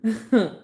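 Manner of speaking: bursts out laughing; big open laugh; loud laugh. Real, not acted. Laughs after quote.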